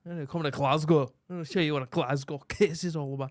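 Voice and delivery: mock-threatening Scottish accent, a little laughter; playful threat in a Scottish brogue. Answering his critical Scottish friend, mock-threatening Scottish accent. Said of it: "Scottish accent".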